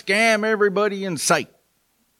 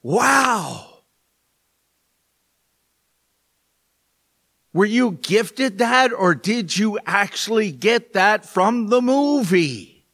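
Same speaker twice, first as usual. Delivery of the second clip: higher, slow impressed wow; mock amazement; 4 semitones higher, slower than usual. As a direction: play up being amazed by the tie. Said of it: "The other one is".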